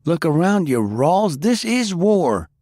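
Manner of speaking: high, loud shout, slowing on the last words; loud, high and slow yell. Acted. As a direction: throw your arms wide and shout it.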